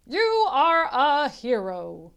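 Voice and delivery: lightly voiced proud fire chief, slow; hearty fire chief, drawn out; booming chief praising a young hero.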